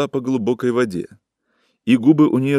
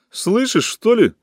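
high, sharp shout; 12 semitones higher than usual. A narrator's reading. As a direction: yell at him, pitched way up. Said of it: "крикнула женщина".

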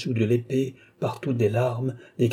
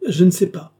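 louder, higher panicked repeat; frightened outcry; 7 semitones higher, 7 dB louder than usual. Acted. Marie-Antoinette cries out to the dauphin in fear, louder. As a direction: break into a real cry the second time. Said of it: "s'écrie Marie-Antoinette".

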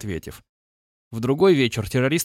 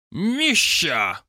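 put-on Caucasian accent; 4 semitones higher than usual. A narrator's reading. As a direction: holler «Мища» in a Caucasian accent. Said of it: "заорал террорист". (кавказский акцент, окрик)

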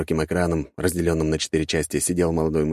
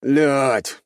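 high, raised cry of dismay; shouted curse; 8 semitones higher, 4 dB louder than usual. A narrator's reading. Sixth gunner cursing as the battle turns, shouted. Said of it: "раздался крик шестого".

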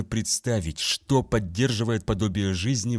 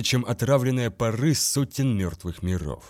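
ordinary delivery

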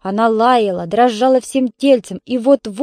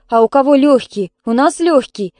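loud, higher indignant retort; 4 semitones higher, 6 dB louder than usual. A narrator's reading. Neighbour disputes whose work is hard, indignant. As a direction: throw the question back indignantly. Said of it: "возмутилась Ольга Петровна".

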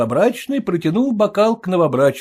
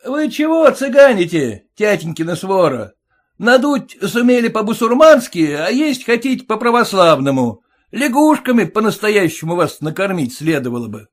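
high, loud, rude shout at the aunts; rude groom; 7 semitones higher, 5 dB louder than usual. Acted. Groom yelling rudely at the bride's aunts. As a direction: bark at them with contempt.

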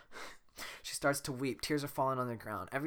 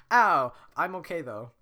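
loud yelp, then brushing it off; pained but cheerful; 5 semitones higher, 11 dB louder than usual. Acted. Donkey after hitting the ground, loud and chipper. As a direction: shrug off the fall.

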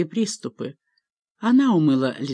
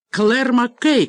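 loud, higher call of a full name; raised voice, demanding; angry father's shout; 6 semitones higher, 5 dB louder, slower than usual. A narrator's reading. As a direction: summon a daughter sternly. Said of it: "крикнул он".

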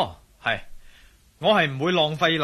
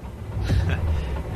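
dismissive laugh. Acted. Wesley laughing off Jack's lecture on duty. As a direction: brush it off with a laugh.

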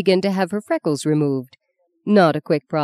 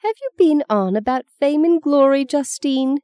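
higher, louder grandmother's challenge; demanding grandmother; 10 semitones higher, 4 dB louder than usual. A narrator's reading. Grandmother demands an answer from Justine, stern and higher. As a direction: press her like a stern old nana. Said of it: "her grandmother demanded".

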